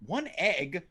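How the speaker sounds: ordinary talk, nothing marked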